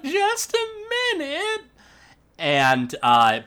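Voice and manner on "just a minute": put-on high, thin old woman calling; old grandma voice. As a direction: sing it out like a sweet old granny. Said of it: "she once again is like".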